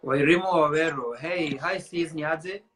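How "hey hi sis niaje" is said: ordinary talk, nothing marked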